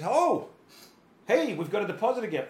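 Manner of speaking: high, loud, fast, pleasantly surprised; a banker thrilled by a surprise deposit; delighted bank manager. Retold or imitated. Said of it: "The bank manager goes".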